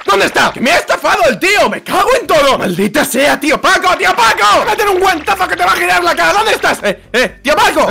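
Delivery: high-pitched furious ranting; outraged. Pretend. Swindled customer hunts the shopkeeper, outraged ranting. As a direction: rant at the top of your pitch. Discovers he was swindled, rages.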